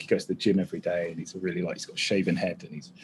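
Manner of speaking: ordinary talk, nothing marked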